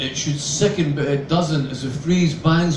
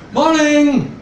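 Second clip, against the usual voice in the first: much higher, loud bright greeting; brisk cheery shout; 11 semitones higher, 5 dB louder than usual. A narrator's reading. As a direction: call out a mocking cheery good morning. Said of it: "I shout in brisk cheer".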